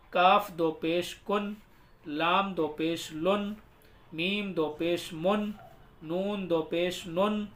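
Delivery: slow, measured sing-song drill; spelling recitation. Shown as a demonstration. Teacher leading learners through do pesh, measured recitation. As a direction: chant each letter, then its sound.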